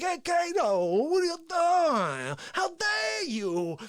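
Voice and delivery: high, slow, hammed-up shouting; Face impression. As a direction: scold the wall in a put-on voice. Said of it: "And he's like".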